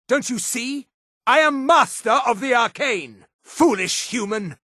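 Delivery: high, grandiose proclamations; villainous battle taunts. Acted. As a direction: lord it over a lesser foe. Mage taunts enemy in battle.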